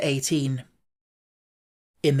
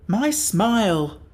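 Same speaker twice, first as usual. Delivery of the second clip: slow, drawn-out words; slow and deliberate; slow Jack Pumpkinhead; 6 semitones higher, 3 dB louder, much slower than usual. A narrator's reading. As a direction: savour each word slowly. Jack speaks slowly.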